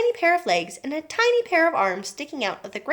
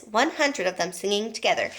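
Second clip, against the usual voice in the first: ordinary delivery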